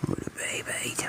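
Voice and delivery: breathless whispered praise to a pet; hushed coo to a purring cat. Real, not acted. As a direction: whisper sweet nothings to the cat. Whispering baby talk to cat.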